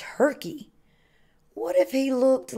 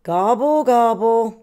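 louder turkey gobbling; a mild grumble in gobbles; grumbled gobble; 5 dB louder than usual. A narrator's reading. Turkey reacts to Rooster's criticism, gobbling in a mild grumble. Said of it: "grumbled Turkey".

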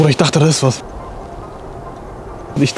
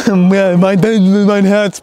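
higher, stammering after the scare; real fright; 4 semitones higher, 4 dB louder, much slower than usual. Real, not acted. Shaken friend after a jump scare, startled and stammering. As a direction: stumble over the words, heart racing.

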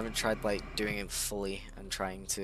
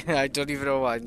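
usual speaking voice

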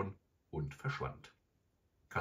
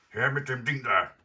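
gruff robber, loud and raised; barsche Stimme; 8 semitones higher, 10 dB louder than usual. A narrator's reading. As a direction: bellow it as a brusque demand. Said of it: "eine barsche Stimme rief".

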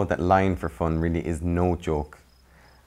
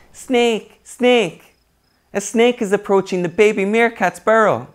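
high, loud put-on meerkat cry; barking alarm call; 10 dB louder than usual. Bored meerkat Millie fooling the colony, high loud alarm cry. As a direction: yell the false alarm high and bright. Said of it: "barked out".

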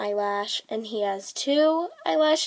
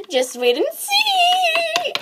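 high slow gleeful tease; 7 dB louder, much slower than usual. Acted. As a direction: gasp and gloat. Witch gleefully teasing.

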